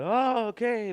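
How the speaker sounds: sudden high "Oh, okay" of realizing; a loud, high "Oh" as it clicks; aha moment. Retold or imitated. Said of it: "these aha moments".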